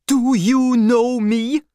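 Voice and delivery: loud, high, slow challenge; forceful demand. Acted. Doctor grabs the soldier and demands, forceful. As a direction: seize him and demand. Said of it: "took hold of the man and demanded".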